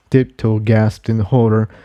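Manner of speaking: ordinary delivery, nothing marked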